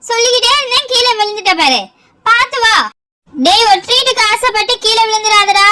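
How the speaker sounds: ordinary talk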